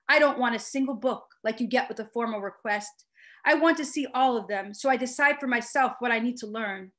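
ordinary delivery

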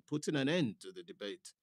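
usual speaking voice

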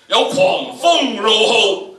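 very loud, high and slow; roaring storm. Shown as a demonstration. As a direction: howl it out at the top of the voice. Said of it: "呢度起碼要八度、九度啦".

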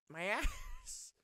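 high squeal breaking into laughter; laughing. Real, not acted. Gamer to viewers, broke character's bones, laughing. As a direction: laugh through the words.

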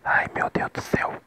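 breathy whispered dismay; whispering. Real, not acted. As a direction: whisper it in real alarm. Player hears enemies shooting at him. (sussurrando apavorado)

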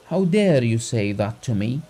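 usual speaking voice

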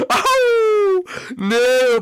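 high, loud cry of loss; dismayed scream. Real, not acted. Losing the musical chairs round, screaming in dismay. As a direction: shriek as the chair is lost.

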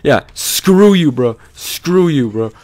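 loud defiant taunt; defiant yelling. Real, not acted. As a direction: yell it at the monster. Yelling at escaped monster.

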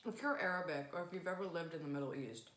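chewing between words; talking around food; mouth full. Real, not acted. Seen 0: Left speaker bit cookie just before; mouth busy while talking, holds piece.

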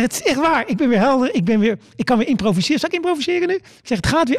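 high, excited announcing; elated. Retold or imitated. Comedian tells the audience he's back, elated and high.

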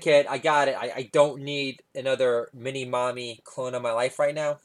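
ordinary delivery

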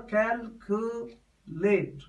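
"calculate" slowly divided as cal, cu, late; syllable division. Shown as a demonstration. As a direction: pause between each syllable of "calculate" to show the division. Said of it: "Syllable division".

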